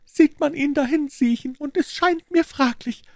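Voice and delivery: put-on high, worried old housekeeper voice; fretting landlady pleading urgently; anxious housekeeper.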